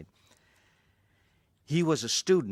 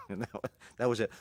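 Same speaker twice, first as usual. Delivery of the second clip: ordinary talk, nothing marked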